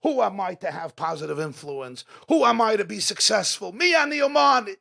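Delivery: building from firm to loud, high exclamation; rising incredulous protest. Acted. Preacher voicing people afraid to succeed, increasingly loud protest. Acts out self-doubting questions, building.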